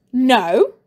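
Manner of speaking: loud curt refusal; snapped. Acted. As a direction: snap the no. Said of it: "snapped Mister Pent".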